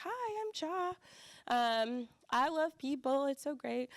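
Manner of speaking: very high, chirpy self-introduction; naive bubbly self. Retold or imitated. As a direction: play your younger self, sky-high and eager. Her naive younger self greeting.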